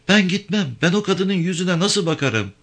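high-pitched woman refusing; distressed woman. Acted. Avke too ashamed to go home, distressed woman.

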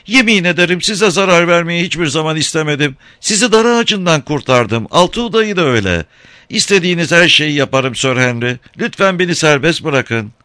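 very high, whining begging; wailing plea. A narrator's reading. Captive clinging to the carriage, whining and pleading. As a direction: moan and beg in a high voice. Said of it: "inleyip sızlıyordu".

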